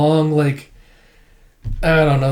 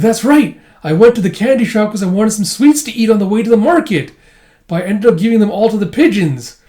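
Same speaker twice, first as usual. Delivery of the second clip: put-on higher, louder animated old man; acted eager recollection; 6 semitones higher, 4 dB louder than usual. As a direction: recall it with hammed-up enthusiasm. Old man proudly explains, retold.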